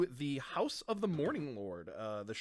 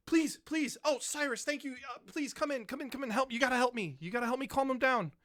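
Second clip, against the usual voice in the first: high, pleading priest calling for help; priest yelling; 10 semitones higher, faster than usual. Acted. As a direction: beg the newcomers to come in and help. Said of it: "Mishan, uh, yells out".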